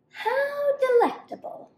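very high, dreamy sigh of longing; sighing monkey. Acted. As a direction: swoon over the bananas up high. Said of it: "she sighed".

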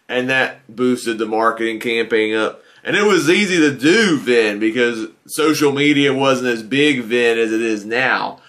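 ordinary talk

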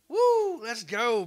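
high, loud whoop of joy; real joyful woo and cheer; excited cheer. Real, not acted. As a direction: whoop it up like a win. Celebrating a donation.

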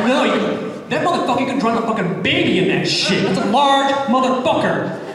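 high, eager barista on a cursing roll; acted thrilled outburst; pent-up excitement. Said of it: "seething in his soul the entire time to just say this".

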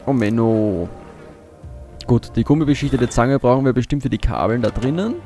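ordinary talk, nothing marked

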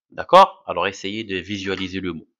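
ordinary delivery

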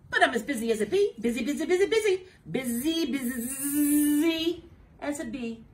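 loud, fast busy chatter; loud busy bee buzz. Acted.

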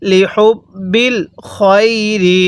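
slow লি হুব বিল খয়রি with airy h and long vowels; drawn-out recitation. Shown as a demonstration. Teacher models the line for learners, slow recited লি হুব বিল খয়রি. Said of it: "এরকমভাবে শিখব".

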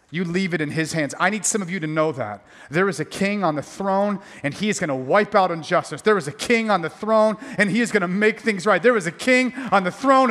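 ordinary talk, nothing marked

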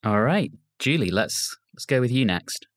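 normal talking voice

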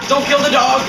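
high, loud command; urgent shouted order. Real, not acted. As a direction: shout it to stop a teammate. Shouted order before correcting himself.

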